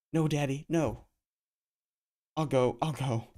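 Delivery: high, loud frightened pleading; girl begging pimp not to hit her. Acted. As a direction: beg him to stop. Girl begs after slap.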